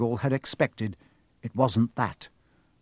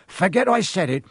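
higher, louder call after them; alarmed call; 6 semitones higher, 7 dB louder than usual. Acted. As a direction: call out as they hurry away. Hagrid alarmed as kids leave.

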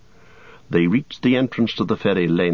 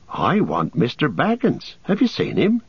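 muffled voice; 7 semitones higher than usual. A narrator's reading. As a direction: voice muffled by a scarf. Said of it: "said a muffled voice".

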